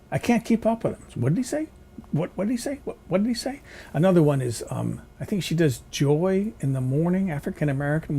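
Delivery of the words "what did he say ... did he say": confused viewer. Retold or imitated. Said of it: "He talks way too fast".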